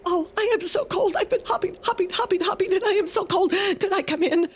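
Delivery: a frozen bunny pleading to come in; shivering rabbit. Acted. Rabbit begs the mouse to let her in, high and whimpering. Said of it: "and she said".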